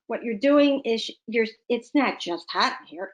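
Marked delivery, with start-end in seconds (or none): none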